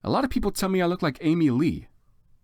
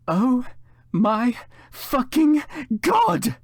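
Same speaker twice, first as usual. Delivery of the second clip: much higher, louder, slow drawn-out mock scream; 11 semitones higher, 4 dB louder, much slower than usual. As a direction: shriek it like a starstruck fan. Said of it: "I screamed".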